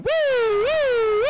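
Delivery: high, loud wailing woo; imitated police siren. Cops suddenly arrive after the rock throw, wailing siren imitation. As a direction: mimic a siren swooping in.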